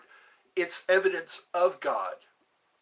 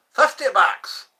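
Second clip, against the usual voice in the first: a mock outraged cry of fifty bucks, much louder; loud exclamation; 5 semitones higher, 11 dB louder than usual. Streamer exclaiming the price to chat, loud. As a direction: shout the price out, big and loud.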